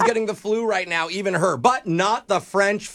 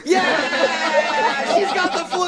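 high, laughing celebration; delighted cheering; 8 semitones higher, much slower than usual. Real, not acted. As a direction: whoop it out high, breaking into laughter. Pranksters cheer her joining in.

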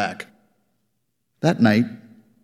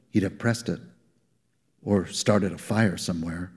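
ordinary delivery, nothing marked

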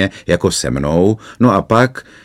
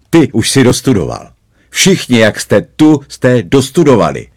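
menacing cop; 5 semitones higher, 5 dB louder than usual. A narrator's reading. Policeman threatens the boys.

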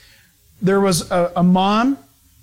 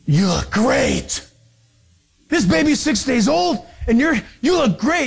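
higher-pitched, amazed stewardess; gushing compliment; 4 semitones higher than usual. Retold or imitated. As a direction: gush in disbelief at a new mother. Acts stewardess gushing at new mother.

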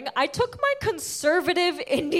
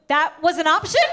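higher, louder comic disbelief; incredulous; 4 semitones higher, 5 dB louder than usual. Retold or imitated. Daughter learning no beating was an option, incredulous. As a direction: question it in mock outrage.

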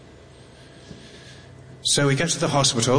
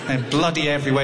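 usual speaking voice

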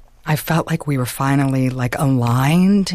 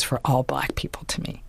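drops to a real whisper; 3 semitones lower, 6 dB quieter than usual. Real, not acted. Telling the interviewer what the word meant to her, whispered. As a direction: let it fall to a breath, almost to herself. Confiding aside about her father.

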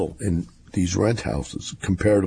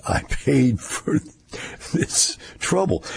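slow, higher, breathy laugh on the words; laughing through it; 6 semitones higher, slower than usual. Real, not acted. Owner laughing about his paid-for dog trouble, laughing.

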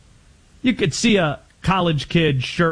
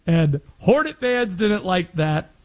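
normal talking voice

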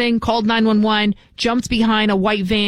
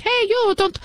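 put-on Irish accent; 12 semitones higher than usual. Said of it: "I'm trying to do my Irish".

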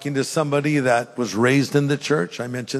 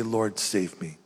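low, soft earnest prayer; quiet plea; 6 semitones lower, 8 dB quieter, slower than usual. Shown as a demonstration. Preacher models a sinner's quiet prayer, low and humble. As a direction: humbly ask for rescue under the breath. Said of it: "You need to say".

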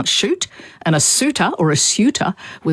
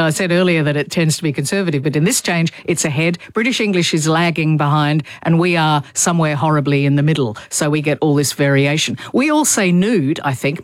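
normal talking voice

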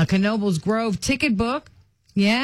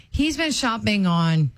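ordinary talk, nothing marked

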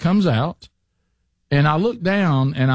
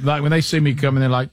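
ordinary talk

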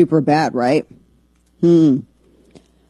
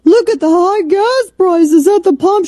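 high, shrill-free mock congresswoman whine, raised and louder; mocking Marjorie Taylor Greene; 13 semitones higher, 4 dB louder than usual. Host mocking a congresswoman's gas price video in a high mock whine. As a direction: lampoon her video voice, pitched way up. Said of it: "you have Marjorie Taylor Greene".

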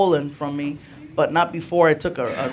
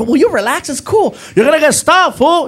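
higher, louder exasperated yell; annoyed yell; 8 semitones higher, 5 dB louder than usual. Retold or imitated. Driver snapping at friend, higher and loud. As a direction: yell back at the nagging friend. Acted car quarrel.

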